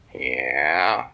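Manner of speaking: put-on a sleepy old turtle answering; lazy turtle voice.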